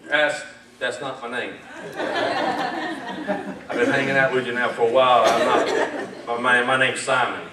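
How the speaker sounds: lightly voiced low, amused protest; a hint of a puzzled Simon. Simon objecting to Jesus about his name, lightly voiced.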